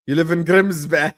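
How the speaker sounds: mocking tease. Real, not acted. Streamer teasing a friend about Grimsby, mocking. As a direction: rub it in.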